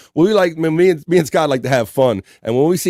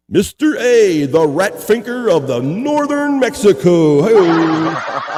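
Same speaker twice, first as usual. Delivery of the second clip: wrestling announcer; 5 semitones higher, 4 dB louder, much slower than usual. Pretend. Wrestling match introduction.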